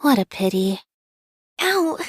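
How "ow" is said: a quick high yelp of pain; sharp ow. Acted. Startled by a noise, a high pained yelp.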